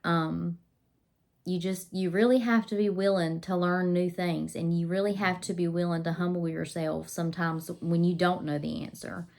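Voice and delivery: ordinary talk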